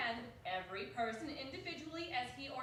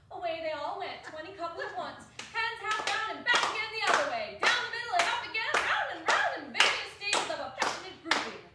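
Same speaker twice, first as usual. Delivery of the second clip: excited narration; 7 semitones higher, 11 dB louder than usual. A narrator's reading. Narration of the lively dance.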